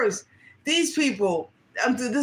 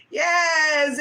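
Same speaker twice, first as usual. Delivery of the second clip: excited yes; 7 semitones higher, 3 dB louder than usual. Real, not acted. Excited burst after a laugh.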